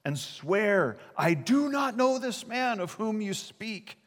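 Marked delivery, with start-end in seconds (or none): 1.2-4.0 high, strained denial; cursing denial. Retold or imitated. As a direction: swear it off, much higher. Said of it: "he began to curse and swear".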